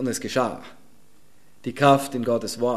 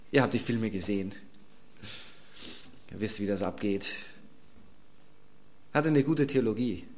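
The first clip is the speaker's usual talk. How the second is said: ordinary delivery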